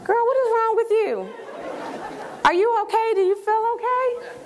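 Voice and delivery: high-pitched, incredulous concern; baffled girlfriend. Acted. Girlfriend reacting to a canned sales pitch, high and baffled. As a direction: play the friend who thinks you have lost it. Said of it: "My girlfriend would have been looking at me like".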